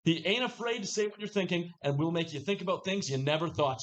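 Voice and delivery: normal talking voice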